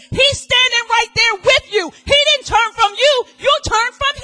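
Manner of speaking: high, loud proclaim; full-force sermon peak; climax preaching. Acted. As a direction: thunder it out.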